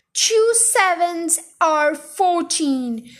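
very slow, drawn-out bellow. A narrator's reading. Headmistress drills the dangling boy, bellowing slowly. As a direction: drum in each word at half speed. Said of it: "Miss Trunchbull bellowed".

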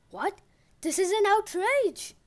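outraged queen. Acted. The queen hears the daughter was stolen, loud outrage. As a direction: boom out royal outrage.

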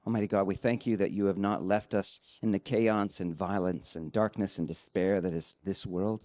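ordinary talk

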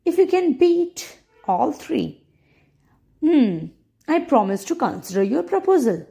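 playful, lilting royal challenge; teasing princess. Acted. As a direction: tease him with a proud challenge.

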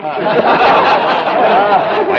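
high, loud scheming cackle; hammed-up villain laugh; gleeful cackle. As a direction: cackle over the fleeced mark. Con man laughs at easy mark.

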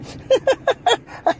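high giggling laugh; high-pitched chuckle. Real, not acted. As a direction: giggle at your own joke. Laughs at own wrestling joke.

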